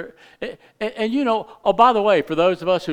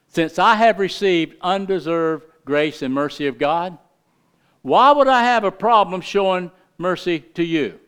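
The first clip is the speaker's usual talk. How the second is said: louder preaching, building to the question; full-force preaching; 4 semitones higher, 6 dB louder than usual. Acted. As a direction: drive it home from the pulpit.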